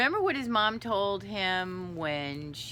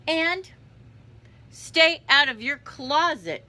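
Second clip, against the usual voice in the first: higher, louder parting command; stern mother's order; 10 semitones higher, 12 dB louder, slower than usual. Acted. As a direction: call out a firm motherly warning. Mother orders kitten from doorway.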